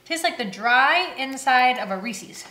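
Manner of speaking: talking while chewing; mouth partly full. Real, not acted. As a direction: food in mouth. Seen 1: Closed-lip chewing at start; holds bitten candy while talking after.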